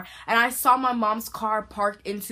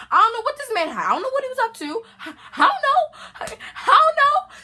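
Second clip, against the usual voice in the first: frantic, ever higher; rising panic; 13 semitones higher, 6 dB louder, faster than usual. Retold or imitated. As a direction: pitch climbing very high and loud. Rising panic about the man.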